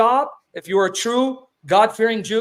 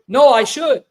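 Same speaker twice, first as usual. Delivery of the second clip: loud, higher, emphatic rebuttal; forceful contradiction; 4 semitones higher, 8 dB louder, slower than usual. Real, not acted. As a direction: push back hard, raising your voice. Contradicting opponent's answer in argument.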